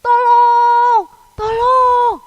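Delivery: loud, high cry for help; drawn-out, high-pitched yell for help; shouting for help. Acted. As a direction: shout out as if calling for rescue. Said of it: "berteriak-teriak".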